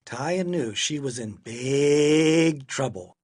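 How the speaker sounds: ordinary delivery, nothing marked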